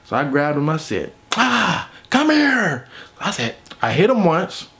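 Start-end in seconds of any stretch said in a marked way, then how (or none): 1.4-2.9 high, strained drawn-out cry; acted effortful lunge, pitched way up; angry grab. Storyteller grabbing his roommate, angry. Got mad, grabbed him.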